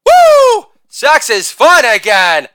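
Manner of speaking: high whoop, then a loud cheer; acted triumphant shout; exultant yell. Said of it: "as I yelled".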